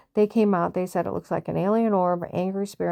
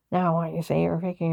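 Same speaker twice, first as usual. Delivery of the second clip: ordinary talk, nothing marked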